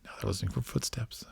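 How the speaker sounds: hushed, breathy whisper as if someone's listening. Real, not acted. Narrating to a friend while characters listen, whispered. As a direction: whisper so no one hears. Hushed tense cartoon scene.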